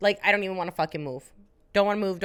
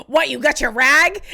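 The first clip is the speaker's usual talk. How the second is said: loud, pitched-up mocking yell; brother's taunt; 6 semitones higher, 8 dB louder than usual. Brother goading sister into a fight, loud mock taunt. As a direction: taunt your sibling at the top of your voice.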